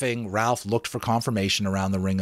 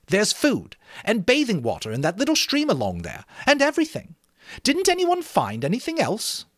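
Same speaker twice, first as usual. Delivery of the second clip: put-on higher boyish voice, pushing; boy Jack, boastful; 12 semitones higher than usual. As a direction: brag then challenge.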